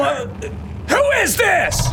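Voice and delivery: high, drawn-out shout into the phone; startled, then cry out at a sinister caller; panicked phone shout; alarmed demand. Acted.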